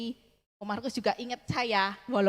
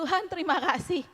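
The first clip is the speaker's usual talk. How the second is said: tearful thanks; 6 semitones higher than usual. Retold or imitated. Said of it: "saya nangis".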